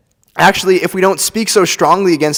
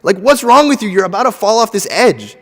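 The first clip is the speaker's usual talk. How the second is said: much higher and louder, yelling a warning; loud alarmed cry of look out; urgent shouted warning; 10 semitones higher, 8 dB louder than usual. Shown as a demonstration. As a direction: shout it like someone is about to fall. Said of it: "what you should do is tell them".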